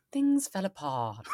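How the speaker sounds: put-on a quick playful go at a Welsh accent; Jon Ronson's Welsh accent. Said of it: "Do his accent".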